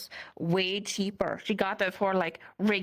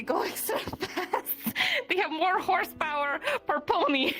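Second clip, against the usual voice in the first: giggling through the words, pitched up; laughing; 9 semitones higher than usual. Real, not acted. Player explaining speedy ponies to companion, laughing. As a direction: barely get it out for laughing. Cracking up at own pony theory.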